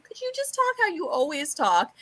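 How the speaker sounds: high, fed-up plea to politicians; high mock-annoyed request; exasperated plea.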